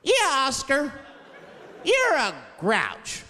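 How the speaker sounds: put-on slow singsong kids show voice; kids show host. Show characters teasing Oscar, slow singsong voice. Mocking Sesame Street characters.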